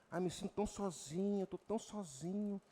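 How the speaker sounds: mock self-pitying moan. Preacher mocking a lonely believer's moan. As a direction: pity yourself in a small voice. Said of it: "Tem crente que fala aqui".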